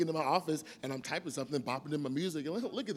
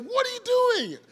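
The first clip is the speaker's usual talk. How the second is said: startled wife; 7 dB louder, faster than usual. Retold or imitated. Wife woken, annoyed.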